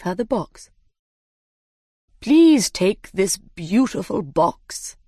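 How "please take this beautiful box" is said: slow, ceremonious dodo; dodo character voice. Acted. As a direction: present a prize with drawn-out formality. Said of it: "he said".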